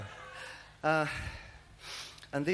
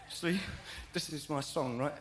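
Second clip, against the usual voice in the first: lightly voiced plaintive comic protest; a hint of a comic character's voice; Norman Wisdom; 5 semitones lower, 15 dB quieter than usual. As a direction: complain like a hard-done-by little comedian, lightly put on. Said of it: "sounds like Norma Wisdom".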